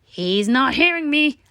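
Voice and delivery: high, slow vengeful tooth voice; threatening tooth. A narrator's reading. Tooth threatens Stuart after gargling, slow and spiteful. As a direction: vow revenge through gritted teeth.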